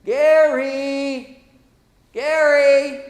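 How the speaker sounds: loud, high, drawn-out name call; acted distant call. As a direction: call out to someone trapped down a well. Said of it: "you hear a voice out there that says".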